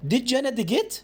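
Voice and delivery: "Did جانا dig it؟" pitched high with a sharp rise on "it"; question intonation. Shown as a demonstration. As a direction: make it clearly a question, rising at the end. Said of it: "بنغمة صوتي أعرفك إن أنا بسألك". (نغمة سؤال صاعدة)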